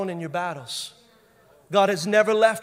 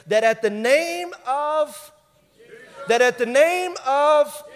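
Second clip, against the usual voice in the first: raised, loud and slow, held on the last word; rousing preaching; 5 semitones higher, 6 dB louder, slower than usual. Real, not acted. Preacher prompting the congregation with scripture, loud drawn-out build. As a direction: build the verse and leave it hanging.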